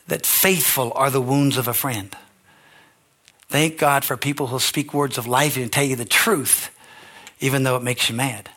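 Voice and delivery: ordinary talk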